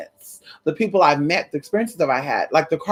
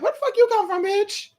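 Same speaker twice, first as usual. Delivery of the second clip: much higher, a squeaky startled voice; shocked squeal; 14 semitones higher than usual. Retold or imitated. Replaying her startled question to the angel. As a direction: let the shock jump way up.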